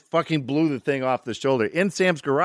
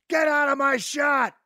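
high, slow imitated shout; director's yell; slower than usual. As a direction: bellow the crew out of the way. Director orders crew out of shot.

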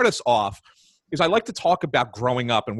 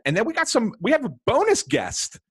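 rising excited announcement; 4 dB louder than usual. Real, not acted. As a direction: build it up with high energy. Hyping the bonus guest.

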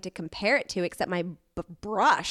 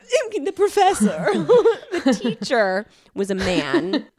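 laughing through the correction, higher and louder; 7 semitones higher, 6 dB louder, slower than usual. Real, not acted. Correcting herself to friends, laughing. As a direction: giggle out the words while correcting.

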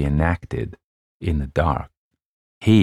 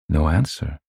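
usual speaking voice

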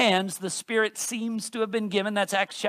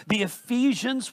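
normal talking voice

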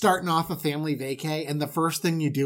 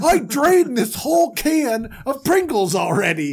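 put-on hyped kid; 6 semitones higher, 5 dB louder than usual. Kids hyped about snacks.

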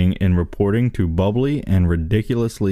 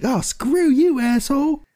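very high, squeaky put-on girlfriend snapping back; mock girlfriend voice. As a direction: shriek it like an offended girlfriend. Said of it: "she's like".

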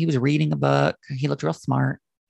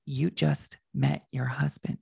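retold whisper; 4 semitones lower, 8 dB quieter, slower than usual. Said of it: "she whispered in my ear".

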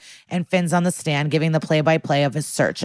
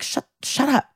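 higher-pitched, lightly acted brush-off; mock irritated retort; 9 semitones higher than usual. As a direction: cut the lawyer off in a mildly mock huff. Retorting in acted courtroom quarrel.

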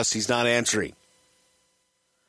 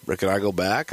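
usual speaking voice

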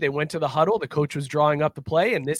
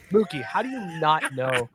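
ordinary talk

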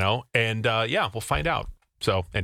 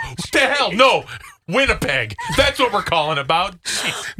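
high, loud sarcastic rant; mock outrage; 7 semitones higher, 3 dB louder than usual. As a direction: shout in pretend disbelief.

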